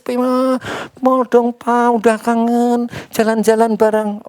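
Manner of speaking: put-on high, pleading child's whine; whining child. Child nags father for a mall trip, high whining plea. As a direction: beg papa to go to the mall like a crying kid. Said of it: "Kalau dia nangis".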